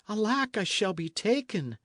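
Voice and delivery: high, alarmed wail of a fugitive; frightened cry. A narrator's reading. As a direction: panic as the pursuers close in. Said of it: "cried the fugitive".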